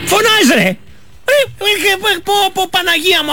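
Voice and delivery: high, hammed-up alarmed yelps; mock fright. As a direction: play scared of the shouting man. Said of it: "Εγώ τον εφοβάμαι". (χαριτωμένος ψεύτικος τρόμος, ψιλή φωνή)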